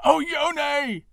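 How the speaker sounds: high, loud desperate calls; calling out. A narrator's reading. Father calls his lost daughter through the bamboo, loud and high. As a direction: call her name across the grove. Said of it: "Again and again".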